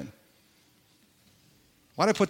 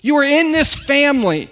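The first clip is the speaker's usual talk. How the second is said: fervent preaching; 9 semitones higher, 5 dB louder, slower than usual. Acted. Sermon opening over music.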